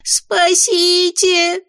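shrill, slow panicked imp yell. Acted. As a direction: scream for help. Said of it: "заорал бес".

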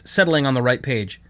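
ordinary delivery

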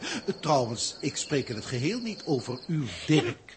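ordinary talk

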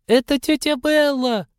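put-on very high, childlike voice; high boy voice. A narrator's reading. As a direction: squeak it out high like a young boy. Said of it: "неожиданно высоким голосом".

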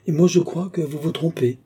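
put-on old man quickly contradicting the young man; lively retort. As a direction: cut in to contradict him with spirit. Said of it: "dit vivement le vieillard".

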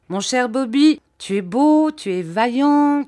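teasing flattery. Acted. Teasing flattery before dismissal.

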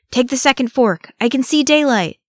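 high, loud urgent call; calling out directions. Acted. Ori guides the fleeing Fellowship, calling out. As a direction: shout the route to those running behind.

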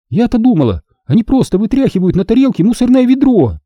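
high-pitched incredulous jibe; mock amazement. A narrator's reading. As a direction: deliver the jibe with wide surprise. Siri's amazed jibe at the food.

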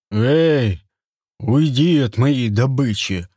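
loud, slow, possessive bark; gruff hunter shout. Acted. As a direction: shout him off like a chief. Turgam shouts at the rival.

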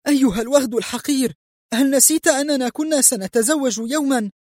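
very high pained protest; angry, pained. A narrator's reading. Woman in an arm twist berating former fiancé, angry. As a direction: cry out indignantly through the pain. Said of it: "صاحت في غضب وهي تتأوه ألما".

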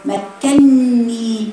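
very slow مكني with the doubled ن held; drawn-out word. Shown as a demonstration. Teacher naming the word for her students. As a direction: hold the ن in مكني long. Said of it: "كلمة".

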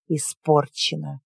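low, slow sour grumble; grumbled. Acted. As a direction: mutter it in disgust. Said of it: "буркнула женщина".

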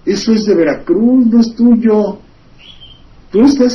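retelling the locals' indignant objection; mock outraged protest. Veracruz locals protesting to the employee, mock outraged. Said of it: "Y los veracruzanos".

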